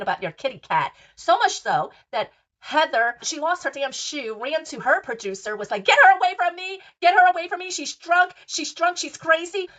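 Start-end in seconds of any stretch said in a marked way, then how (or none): 5.8-9.7 high, loud frantic yelling; panicked shout. Retold or imitated. Heather yelling to her producer to get Whitney away, panicked shout. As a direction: scream for help like someone's chasing you.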